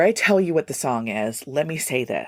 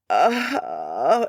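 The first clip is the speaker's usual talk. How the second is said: drawn-out, tired "uh"; weary groan. Real, not acted. Host tired of the singer's remarks, weary groan. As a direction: let out an exasperated groan.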